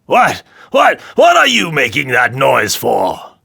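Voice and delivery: angry king demanding an answer; furious demand. A narrator's reading. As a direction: round on the dwarf. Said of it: "turning furiously to the dwarf".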